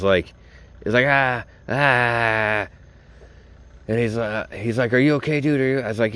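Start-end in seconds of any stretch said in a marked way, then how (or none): 1.1-2.7 higher-pitched imitated moan of pain; drawn-out pretend groan; mock pained cry. Imitates hurt buddy's cries. 4.9-5.8 higher-pitched imitation of a friend checking in; retold urgent check on a hurt friend; concerned buddy. Voices buddy checking on him.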